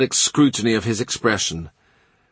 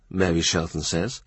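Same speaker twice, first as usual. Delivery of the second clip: usual speaking voice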